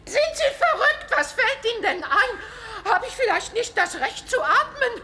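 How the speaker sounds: high, loud outraged squawk; indignant protest. Acted. Caught at the door, the woman loudly protests her innocence. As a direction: bluster to cover your embarrassment.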